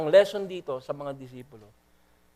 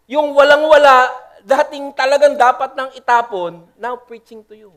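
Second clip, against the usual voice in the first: loud, much higher testimony; shouting his testimony, voice raised high; preaching shout; 7 semitones higher, 10 dB louder than usual. Real, not acted. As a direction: cry it out to the crowd. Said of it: "kaya ako sumisigaw".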